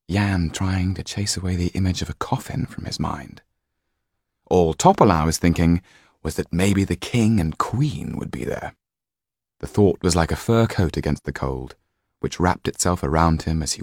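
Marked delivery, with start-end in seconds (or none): none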